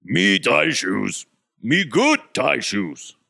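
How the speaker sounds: slow, dim-witted caveman voice; mock simpleton; dumb caveman talk. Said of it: "Tyler Polumbus type guy".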